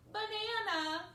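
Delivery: high, loud, hilarious punchline; laughing glee. A narrator's reading. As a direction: shout the word in high glee. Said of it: "They are just laughing".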